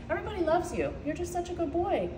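soft cooing praise; doting pet talk. Real, not acted. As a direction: praise your dog tenderly and softly. Praising her pet dog.

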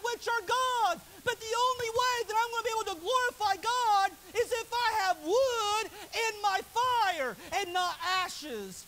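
loud, high, slow preacher's call; preaching at full force. Acted. Preacher crying out a prayer to God. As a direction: drive it home at full volume.

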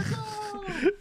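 high-pitched laugh trailing from a squeal. Real, not acted. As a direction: let a squeal break into laughter. Reacting to the meal.

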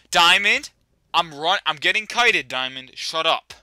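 loud, high scolding; exasperated shout. Real, not acted. Player being chased in game, exasperated shouting at dog. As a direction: shout at a pet while under pressure.

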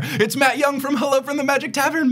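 high, sustained announcer's reveal; big guest introduction. Acted. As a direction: sing out the guest's name at a high pitch. Climax of the guest's grand introduction.

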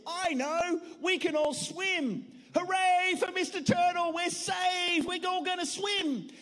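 high, cheerful hooray; high excited cheering; cheering turtle. Acted. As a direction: celebrate being saved. Mister Turtle cheers the plan.

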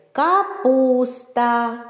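Капуста drawn out syllable by syllable; повільно по складах. Shown as a demonstration. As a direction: sound out Капуста slowly, stretching the vowels. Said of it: "в назві яких є звук О". («Капуста» повільно, протяжно по складах)

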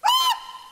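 very high, loud shriek; mock startled scream. Preacher acting the reaction to "boo", mock scream. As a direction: yelp as if someone jumped out.

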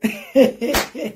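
a real burst of laughter; genuine laughing fit. Real, not acted.

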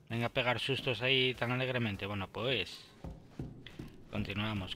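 ordinary talk, nothing marked

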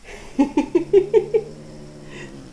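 high breathy burst of laughter; high airy giggle; delighted laugh. Real, not acted. Cat attacks squeaky mouse.

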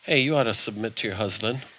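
low, soft voice of conscience; quiet Holy Spirit voice. Retold or imitated. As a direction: hush it down like an inner nudge. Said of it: "the Holy Spirit will come along".

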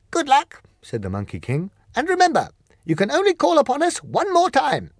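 high, loud creature voice, firm; polite but final refusal; Monkey King declining, high and loud; Monkey King refusing. Acted.

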